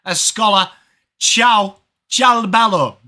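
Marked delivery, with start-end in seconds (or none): 1.2-3.0 mock Italian. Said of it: "that's pretty good Italian".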